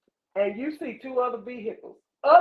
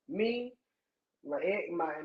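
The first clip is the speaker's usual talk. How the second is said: ordinary delivery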